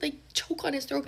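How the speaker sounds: high, rising panic; panicked. Retold or imitated. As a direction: squeal it like he might die. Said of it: "I'm, like, freaking out".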